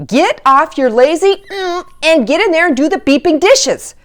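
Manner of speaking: fed-up yell. Retold or imitated. Parent finally snaps at lazy teen, loud mock yell. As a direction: blow up at the kid on the couch.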